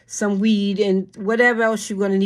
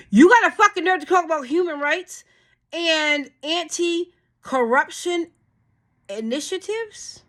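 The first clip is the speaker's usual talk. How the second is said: angry outburst; 9 semitones higher than usual. Real, not acted. Furious at the lawmakers over human rights talk. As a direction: call out the hypocrisy.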